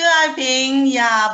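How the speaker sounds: ordinary talk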